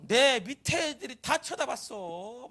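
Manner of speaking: high, drawn-out scornful reproach; sarcastic complaint. Acted. Michal scolding David because the servants all stared, scornfully. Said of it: "막 비꼬겠지".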